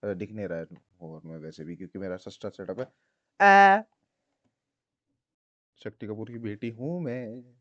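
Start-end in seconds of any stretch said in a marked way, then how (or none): 3.5-3.8 high loud yelp; sudden yelp. Real, not acted.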